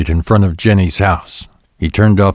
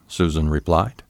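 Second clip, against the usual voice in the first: ordinary delivery, nothing marked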